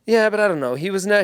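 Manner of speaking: usual speaking voice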